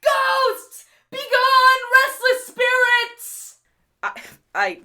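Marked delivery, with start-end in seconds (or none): 0.0-3.5 high, panicked cry to drive off ghosts; a scared guard half singing his warding cry; terrified gatekeeper. Acted. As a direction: shriek at the spirits in fright.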